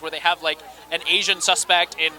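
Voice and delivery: usual speaking voice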